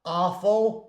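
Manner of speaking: American AWFUL, open "ah" at the start; American accent. Shown as a demonstration. Teacher repeating the American version, American accent. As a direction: say "Awful" with an American vowel, "ahful". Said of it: "in American English".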